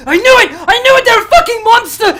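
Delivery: high, loud terrified shout; freaked-out scream. Acted. Doctor shrieking at the monster's fur. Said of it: "that freaks him out even more".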